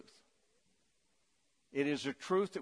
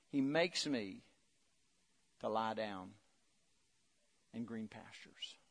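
soft, slowing, fading on "green pastures"; savoring quiet reread; 7 semitones lower, 8 dB quieter than usual. Shown as a demonstration. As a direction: read it again tenderly, letting it sink in. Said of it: "I just like the way it says it".

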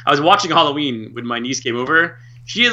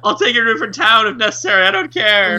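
mock toddler pleading; 8 semitones higher, 4 dB louder than usual. Niece voice continues.

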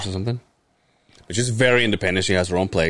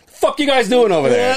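high, loud, suspicious demand; mock yell; 5 dB louder than usual. Demanding answers from his family in the car, loud. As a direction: yell it across the street.

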